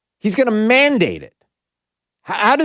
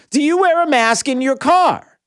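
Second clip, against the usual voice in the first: raised, high-pitched challenge to listeners; frustrated yelling; 8 semitones higher than usual. Real, not acted. Radio host challenges callers about masks in cars, frustrated yelling. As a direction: demand an answer, pitched high and exasperated. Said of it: "I'm yelling because".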